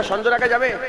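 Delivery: usual speaking voice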